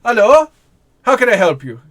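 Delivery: put-on high, loud old man answering the door; startled old man at the door; old wizard voice.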